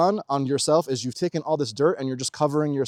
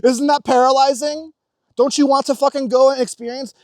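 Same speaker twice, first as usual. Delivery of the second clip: higher, louder pleading rant; higher, louder, passionate challenge; fired-up appeal; 11 semitones higher, 7 dB louder than usual. Real, not acted. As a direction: urge them on, all fired up. Worked-up appeal to viewers.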